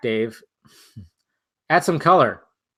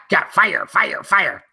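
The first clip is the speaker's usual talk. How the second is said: strained cartoon Beavis chanting fire; hammed-up Beavis yelling fire, fire; Beavis impression; slower than usual. Doing Beavis for the stream, a strained cartoon voice. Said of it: "I can do a Beavis impression".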